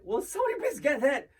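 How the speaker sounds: high, loud mock outburst; exasperated yell. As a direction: call out in mock exasperation over a ringing phone. Acted exasperation at ringing phone.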